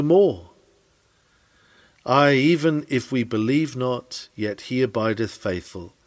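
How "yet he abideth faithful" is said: ordinary talk, nothing marked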